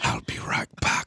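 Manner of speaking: soft villainous parting threat; menacing whisper. Acted. Comedian imitating actors being super menacing, soft whisper. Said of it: "super menacing".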